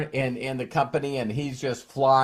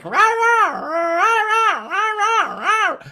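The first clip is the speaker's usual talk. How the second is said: much higher, louder cartoon-pet yapping; Dino from the Flintstones; 4 dB louder, slower than usual. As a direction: yap like the Flintstones' pet dinosaur. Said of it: "I call him Dino ... from the Flintstones".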